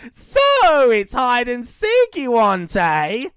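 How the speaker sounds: loud, slow, drawn-out taunt; mocking. A narrator's reading. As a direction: toy with them in a singsong sneer. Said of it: "he said mockingly".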